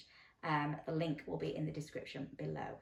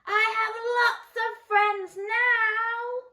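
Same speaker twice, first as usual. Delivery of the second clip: high, slow, loud sung-out call; joyful loud sing-song call; very loudly; 10 dB louder, much slower than usual. Acted. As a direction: shout it out happily across the pond. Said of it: "he said it again very loudly".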